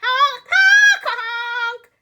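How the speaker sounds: high, loud sing-song honks; goose honking. A narrator's reading. Goose honking out the news, high and loud. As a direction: honk like a laughing goose. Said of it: "they gasped out the news".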